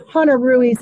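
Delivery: usual speaking voice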